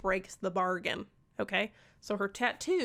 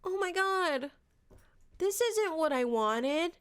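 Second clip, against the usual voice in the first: whiny Tamlin; 7 semitones higher than usual. Retold or imitated. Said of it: "And Tamlin's like".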